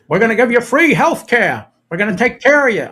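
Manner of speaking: hammed-up Bernie Sanders voice; Bernie Sanders impression. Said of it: "So I can do his voice".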